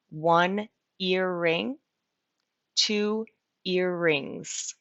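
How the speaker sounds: slow, careful teacher model of the plural; slow clear model. Shown as a demonstration. Teacher modelling singular and plural for students, slow and clear. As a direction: spell it out slowly for learners. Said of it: "two earrings with an S".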